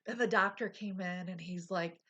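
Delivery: ordinary delivery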